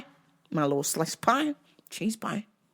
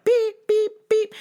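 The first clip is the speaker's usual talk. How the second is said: high honking beep beep; car horn beeps; 6 dB louder, slower than usual. Retold or imitated.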